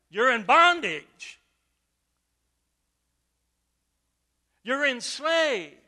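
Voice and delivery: loud, slow pulpit build; preaching at full force. Acted. Pastor preaching hard to his congregation, loud and slow. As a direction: drive it home to the pews.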